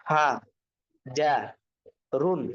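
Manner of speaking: syllable by syllable, long pauses between "হা", "যা", "রুন"; broken pronunciation. Shown as a demonstration. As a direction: sound out "হা ... যা ... রুন", each alone. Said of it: "ভাঙ্গা উচ্চারণ কিভাবে?".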